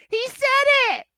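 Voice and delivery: very high, loud hammed-up shout; mock announcer yell. Mocking the announcer shouting over Danielson, loud high yell. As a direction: parody a commentator screaming over it. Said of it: "be like".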